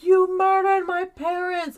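high, loud, drawn-out theatrical shout; mock furious accusation. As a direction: hurl it slowly in hammed-up rage. Enraged accusation from film, retold.

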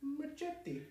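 high, soft echoed Machete, sung up and falling; mock echo. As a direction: say Machete high and quiet, teasing. Echoes the word, mocking.

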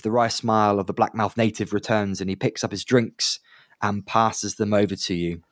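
ordinary talk

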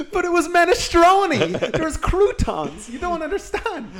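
whiny high-pitched excuse; squeaky defensive excuse. Acted. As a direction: plead your innocence in a squeak. Defensive ancestor pleading excuse.

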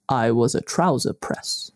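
slow, deep stone golem rumble; deep rumbling voice. Acted. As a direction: grind it out low and slow like a stone giant. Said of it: "says in a deep, rumbling voice".

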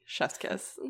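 breathy Italian-chef flourish; mock Italian chef's kiss. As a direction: voice a lip-smacking chef. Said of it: "doing the hand gesture".

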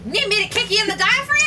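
high quick offer. Acted. Remy offering, voice jumps high.

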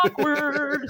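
high, drawn-out "awkward"; teasing singsong. Real, not acted. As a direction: sing it out high to tease. Teasing remark on embarrassing story.

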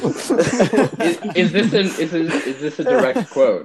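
laughing through the question; can barely ask for laughing. Real, not acted. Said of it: "laughing at the quote".

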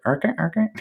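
high squeaky mock bike horn honk; horn squeak imitation. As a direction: squeeze out a silly toy horn sound, way up high. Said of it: "like, horn".

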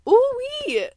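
high whooping ooh-wee. Real, not acted. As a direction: blow out the tension with a whoop. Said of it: "That was Story Noir spicy".

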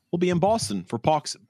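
put-on botched Boston accent, pax as pox; Boston vowels, pax comes out pox. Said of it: "I think I was trying to do a Boston accent".

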